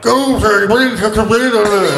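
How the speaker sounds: very high, drawn-out imitation of garbled speech; incomprehensible accent. Imitating people with impossible accents, high garbled babble. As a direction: mimic a barely understandable speaker in a high pitch. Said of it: "some people that were like".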